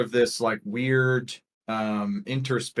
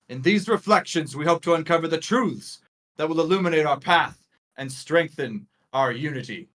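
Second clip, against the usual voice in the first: raised, projected political oratory; rallying speech; 7 semitones higher, 4 dB louder than usual. Acted. Leader rallying the crowd to the quest, oratorical. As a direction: proclaim the mission to the troops, pitched up. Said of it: "like he's sort of rallying, uh, the troops".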